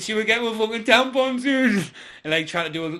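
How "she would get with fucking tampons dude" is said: loud, high-pitched mock woman; hammed-up girlfriend impersonation, pitched way up; girlfriend's voice. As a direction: squeal it out as a put-on girly character. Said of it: "to Lass's voice".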